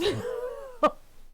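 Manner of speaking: sudden high squealing laugh; high burst of laughter. Real, not acted. Friend told on him, oops.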